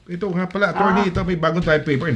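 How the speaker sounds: usual speaking voice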